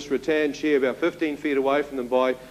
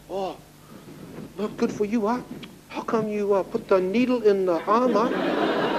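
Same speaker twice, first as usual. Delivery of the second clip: imitating a Chinese accent, higher and chiding; a mocking Chinese accent, pitched up; imitating a young Chinese man in his Chinese accent, teasing; Chinese-accented English; 7 semitones higher than usual. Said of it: "the young Chinese boy stood up, he said".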